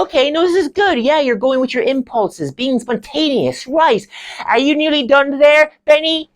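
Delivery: very high, theatrical drama lecturer; Stevie. Acted. Lecturer praising students' impulses, high and effusive. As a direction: gush approval, then chivvy.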